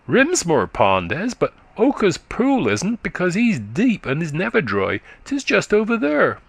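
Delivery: put-on high, quick childish voice of a country boy; little boy chatting up to a tired woman, high and eager; chattering little boy. A narrator's reading. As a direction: prattle like a small eager lad. Boy explains the ponds.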